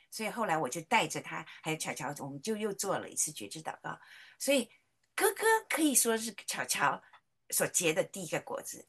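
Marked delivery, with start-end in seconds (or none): none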